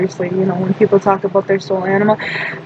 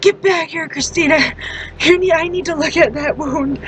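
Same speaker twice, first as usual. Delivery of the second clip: high, insistent mom voice; imitating mom's urgent demand; 6 semitones higher than usual. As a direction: call her back like a worried mother. Mom calling her back, acted.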